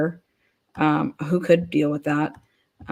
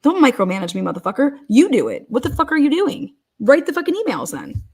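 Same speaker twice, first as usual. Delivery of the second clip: angry rant; 4 dB louder, faster than usual. Retold or imitated. Imagined reply to the office manager, furious loud rant. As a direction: blast the office manager with swearing. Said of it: "And I said".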